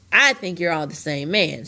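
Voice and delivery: ordinary delivery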